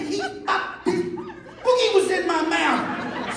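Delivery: high, sputtering mock outrage; distraught protest. Husband explaining himself to wife, distraught protest. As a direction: stammer it out in hammed-up disgust.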